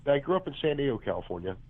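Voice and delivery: ordinary talk, nothing marked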